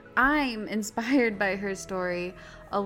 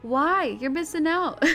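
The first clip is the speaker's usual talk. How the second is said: playful; 7 semitones higher, 6 dB louder than usual. Acted. Playful ad push.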